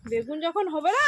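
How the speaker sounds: ordinary talk, nothing marked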